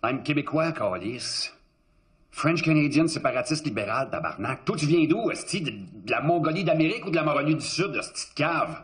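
a hammed-up Québécois cop lashing out; Québécois French. Undercover cop mocking the sheriff, Québécois accent. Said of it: "Okay, that was not French".

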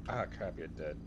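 ordinary delivery

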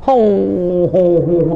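imitated sobs, ho ho ho; preacher mimics a weeping young wife's sobs; mock sobbing. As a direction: fake a wife's crying sobs. Wife crying after being divorced.

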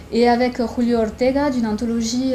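ordinary delivery

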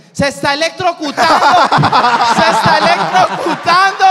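high, loud alarmed yell; frantic shout that he's being electrocuted. Retold or imitated. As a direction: yell the warning as if someone's in danger. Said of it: "cuando grita Keyson".

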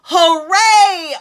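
high, loud burst of a cheer. Acted. The caveman cheering the dinosaurs are gone, loud joyful cheer. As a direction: throw your arms up and cheer. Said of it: "and cheered at what they saw".

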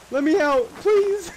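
high-pitched begging; real high, giggly plea; pleading. Real, not acted. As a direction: plead to be let out, voice climbing. Trapped, begging to escape.

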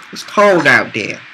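ordinary talk, nothing marked

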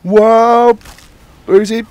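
high, loud whoop and call; excited whoop. Real, not acted. Gamer jolted mid-fight, loud excited whoop. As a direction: burst out in surprise. Sudden action in game.

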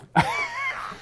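squeaky burst of laughter; a high giggle escapes; high squeal of laughter. Real, not acted. Preacher cracks up at the excuse, high squeal of laughter. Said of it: "I'm sorry I'm laughing".

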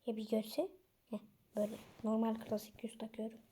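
ordinary talk

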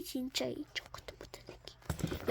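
cursing under the breath; whispered curse. Acted. Loser cursing the winner under his breath. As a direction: hiss the insult quietly.

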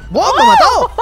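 grito de rabia muy agudo; raging gamer. Real, not acted. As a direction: explota de rabia al morir. Jugador muere en el duelo. (grito furioso de jugador)

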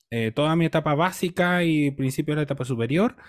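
normal talking voice

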